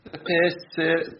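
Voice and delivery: high, slow attempt at Scouse; bad Scouse accent. Podcaster attempting the second test phrase, bad Scouse accent. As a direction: feel out the Liverpool vowels slowly, pitched high. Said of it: "my awful accent".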